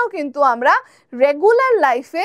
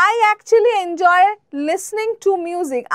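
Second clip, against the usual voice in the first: slow example sentences; 3 semitones higher, much slower than usual. Shown as a demonstration. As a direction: model them slowly for students. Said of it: "example sentence".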